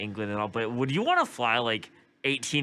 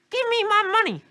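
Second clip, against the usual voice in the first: even higher, louder squeaky granny; old lady voice; 7 dB louder than usual. Acted. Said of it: "I can't do an old lady voice".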